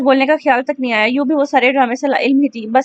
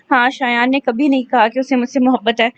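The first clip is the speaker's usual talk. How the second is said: usual speaking voice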